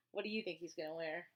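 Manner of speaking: ordinary delivery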